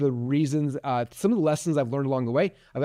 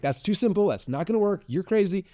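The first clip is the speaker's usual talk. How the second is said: mildly dismissive, pitched higher; retold skeptical objection; 6 semitones higher than usual. Speaker retelling his first doubts about her advice, skeptical and higher.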